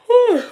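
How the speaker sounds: high, loud whew of release; loud exhale, letting it go; loud relieved whew. Real, not acted. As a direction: blow out the pressure. Letting out tension.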